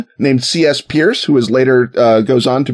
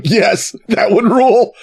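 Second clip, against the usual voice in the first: mock Jefferson; 7 semitones higher than usual. Jefferson voiced in retold story.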